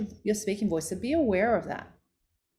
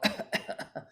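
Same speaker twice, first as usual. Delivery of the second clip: cough; 5 dB quieter than usual. Shown as a demonstration. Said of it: "Avoid throat clearing".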